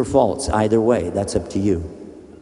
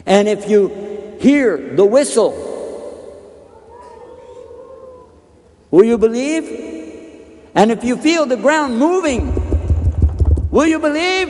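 pleading call; 12 semitones higher, 3 dB louder than usual. Acted. Pleading with the stubborn man.

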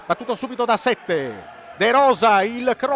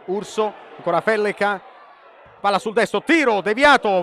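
normal talking voice

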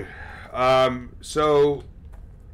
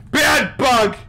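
high, loud telling-off; alarmed, forceful scold; scolding shout; 11 semitones higher, 9 dB louder than usual. Real, not acted. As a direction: yell it like scolding a naughty pet. Scolding the bug louder.

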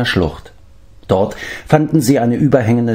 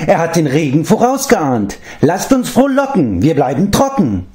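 much higher, eager praise for his master; excited higher creature voice; enthusiastic cry; 9 semitones higher than usual. A narrator's reading. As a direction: gush with delight. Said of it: "rief Gogi begeistert".

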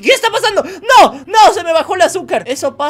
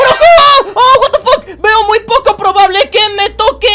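much higher and louder laughing yell; shocked into a laughing shout; 11 semitones higher, 10 dB louder than usual. Real, not acted. Streamer sees fight start, shouts laughing at viewers.

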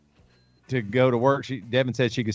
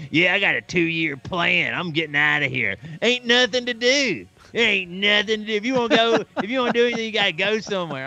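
put-on Southern accent; 8 semitones higher than usual. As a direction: drag it out like a bored Southern local. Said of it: "I give everybody a Southern accent".

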